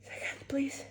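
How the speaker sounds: breathy whisper, holding a sneeze. Real, not acted. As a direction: whisper while fighting a sneeze. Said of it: "I'm super sneezy today".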